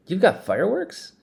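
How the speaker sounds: light up at the news of fireworks; excited question. Acted. Kid hears about fireworks.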